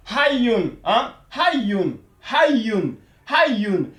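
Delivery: Hayyun repeated with the y held double, hay-yun; teacher repeating hayyun, stretching the double y; doubled y demo. Shown as a demonstration. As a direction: model hayyun so you hear two y sounds. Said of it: "qu'on ait l'impression que le ya est répété deux fois".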